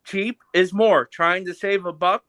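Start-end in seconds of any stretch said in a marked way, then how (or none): 0.0-1.1 drawn out so the listener catches it; slow, deliberate repeat. Real, not acted. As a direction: spell it out slowly. Repeats slowly after listener says What.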